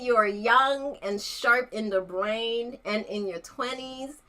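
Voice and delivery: usual speaking voice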